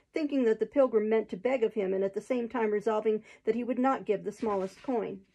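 plain reading voice

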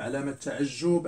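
usual speaking voice

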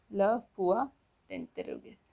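"Le point d'interrogation" repeated in chunks; French pronunciation demo. Shown as a demonstration. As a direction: let "d'interrogation" drop away after "Le point". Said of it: "interrogation mark is pronounced as".

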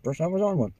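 normal talking voice

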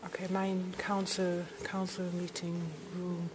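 ordinary delivery

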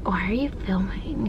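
hushed suspicious whisper. Retold or imitated. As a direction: breathe it like a nosy stranger. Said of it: "she was all like".

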